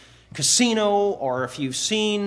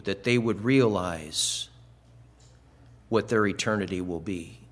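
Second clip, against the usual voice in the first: prayer; 6 semitones lower than usual. Acted. Opening prayer before the sermon.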